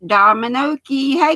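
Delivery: usual speaking voice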